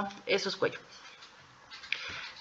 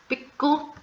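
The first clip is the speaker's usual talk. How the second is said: "big Q" higher with a beat before "Q"; high big Q with pause; 7 semitones higher than usual. Shown as a demonstration. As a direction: pop "big", stop, then "Q". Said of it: "Es un pequeño beat".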